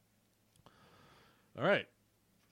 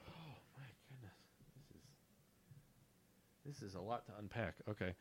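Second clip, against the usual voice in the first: quiet overwhelmed; 2 semitones lower, 19 dB quieter, faster than usual. Real, not acted. Overwhelmed aside.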